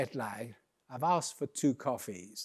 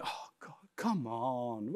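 hushed, drawn-out exasperation; impatient groan; 4 semitones lower, 9 dB quieter, slower than usual. Acted. Waiting queue wondering where Tom is, hushed exasperated groan. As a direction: groan it slowly under the breath. Queue grumbling as they wait.

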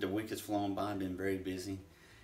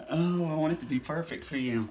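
laughing; 7 semitones higher, 7 dB louder than usual. Real, not acted. Still laughing after the flub.

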